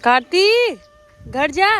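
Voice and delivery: loud, high call of his name; a mother calling her son back. Real, not acted.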